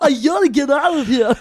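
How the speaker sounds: high, loud hammed-up action hero voice; Arnold Schwarzenegger. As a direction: ham up a movie muscleman. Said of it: "Arnold Schwarzenegger, go".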